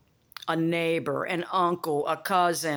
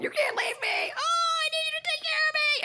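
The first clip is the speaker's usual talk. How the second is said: very high, squealing mock plea; whiny clingy boyfriends. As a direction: squeal it like a needy little boy. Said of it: "They're like".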